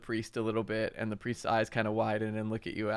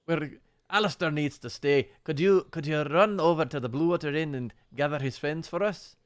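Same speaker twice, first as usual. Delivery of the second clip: put-on Russian accent, higher and louder; 5 semitones higher, 5 dB louder than usual. Priest sending Milivoj to the inn, Russian accent. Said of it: "I'm getting Russian".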